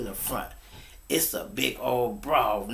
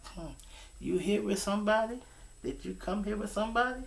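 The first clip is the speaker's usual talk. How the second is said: flirty probing woman; 9 semitones higher, 6 dB quieter, faster than usual. Retold or imitated.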